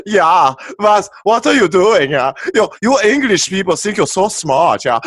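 loud, high mock German accent. Mocking the English in a German accent. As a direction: bark it in a hammed-up German accent. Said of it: "I love the German accent".

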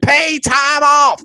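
high, drawn-out whoop of words; gleeful holler. Real, not acted. Fan boasting about vacation plans, gleeful holler. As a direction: stretch it out high, exulting.

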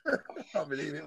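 breathless from laughing; wheezy voice. Real, not acted. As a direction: wheeze out the words through laughter. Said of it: "I can't catch my breath".

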